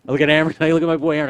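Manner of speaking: ordinary talk, nothing marked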